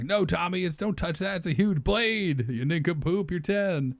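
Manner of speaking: high-pitched, exasperated telling-off; acted sibling scolding. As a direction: play a bossy kid warning off a little brother. Acting older sibling scolding Tommy.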